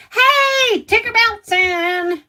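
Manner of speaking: high, loud, stretched-out hello; effusive greeting. Real, not acted. Streamer spotting a viewer arriving, effusive greeting. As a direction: sing out the name in welcome.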